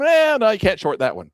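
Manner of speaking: high, loud dismissive refusal; dismissive broker. Acted. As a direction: shoot him down with a loud eh no. Acted trader-broker exchange.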